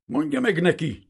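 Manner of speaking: high, loud indignant order; outraged outburst; sudden angry snap. A narrator's reading. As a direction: let the voice crack like a whip. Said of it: "csattant föl a hangja".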